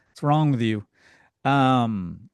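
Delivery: ordinary talk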